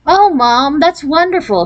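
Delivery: high, happy exclamation; a light, glad cry of joy; delighted exclaim. A narrator's reading. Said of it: "she exclaimed".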